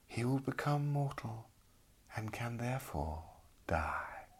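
soft, hushed, otherworldly lady; soft eerie lady voice. A narrator's reading. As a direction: speak gently like a ghostly lady. Lady Plague explains the apple.

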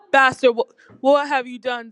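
loud, angry god's yell. A narrator's reading. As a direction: boom the accusation out. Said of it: "Zeus yelled".

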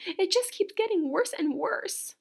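distressed cry. A narrator's reading. Missus Cream despairing over Camilla, high anguished cry. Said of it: "cried Missus Cream".

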